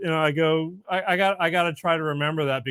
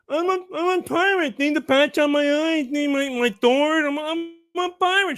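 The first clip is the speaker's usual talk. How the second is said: put-on kid with a cleft lip; 12 semitones higher than usual. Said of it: "he kinda talks like this".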